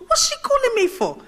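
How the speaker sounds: high, loud, baffled thought. Retold or imitated. Candidate retells the minister phoning her, baffled. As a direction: squeal the question in mock alarm. Retold puzzlement at minister's call.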